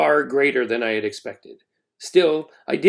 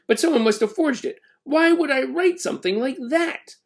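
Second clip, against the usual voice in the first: Ben protesting; 12 semitones higher than usual. A narrator's reading. Ben protests his innocence.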